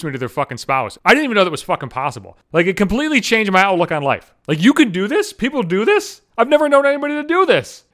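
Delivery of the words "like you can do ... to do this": amazed disbelief. Acted. Amazed that skipping introductions is possible.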